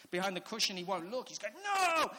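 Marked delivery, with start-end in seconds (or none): 1.5-2.1 loud, high cry of refusal; high-pitched, shouted protest; imitated child's wail. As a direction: yell it like a kid behind a cushion. Son hiding, refusing to look.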